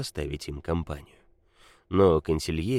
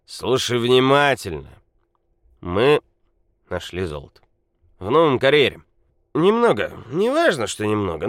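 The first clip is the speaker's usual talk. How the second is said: put-on loud drunk rant; 5 semitones higher, 7 dB louder than usual. A narrator's reading. Drunk Nikola confides loudly.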